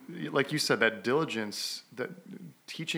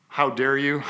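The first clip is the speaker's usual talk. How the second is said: a bit louder, indignant mock scolding; mock angry outburst; 3 semitones higher, 7 dB louder than usual. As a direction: play the angry dad, half-seriously.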